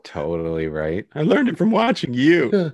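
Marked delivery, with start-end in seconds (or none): 1.1-2.7 high, loud hammed-up accusing kid; after-school special parody. Kid confronting a parent, mock after-school special melodrama. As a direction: overact it like a wronged child. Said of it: "something from an after-school special".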